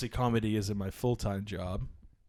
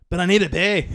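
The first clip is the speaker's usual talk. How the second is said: high, loud Scottish protest; Scottish accent; 6 semitones higher, 8 dB louder than usual. Acted. Scottish customer protests to shop worker, loud Scottish accent. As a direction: insist as a put-on Scottish lady. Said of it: "this Scottish lady walks up to me".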